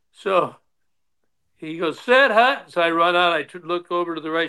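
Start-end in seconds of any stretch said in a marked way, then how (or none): 2.0-2.7 high field call, snapped out; mock quarterback bark, light; called-out snap count. Brother-in-law calls the snap.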